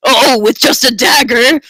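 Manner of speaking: slow, gushing cry of admiration; swooning admirer. Acted. Tonda swoons over the hero's dagger, gushing and slow. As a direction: draw out the praise, swooning.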